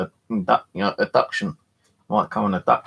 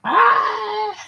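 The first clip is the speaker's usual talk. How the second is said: sudden very high pained shriek; a real startled yelp of pain; 5 dB louder than usual. Real, not acted. Skull bites his finger.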